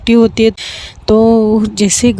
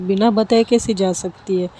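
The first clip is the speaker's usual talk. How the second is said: usual speaking voice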